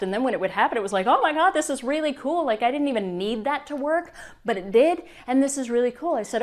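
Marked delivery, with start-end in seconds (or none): 1.1-6.1 higher, brighter gush of surprise; delighted. Retold or imitated. Client recalling money arriving, delighted and higher. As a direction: gush like money just landed.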